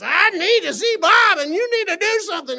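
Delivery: very high angry yell; mad and angry. Retold or imitated. Man losing his temper at jail clerk, angry yell. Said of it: "he got mad and he got angry".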